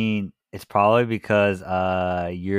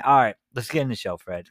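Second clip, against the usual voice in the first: ordinary talk, nothing marked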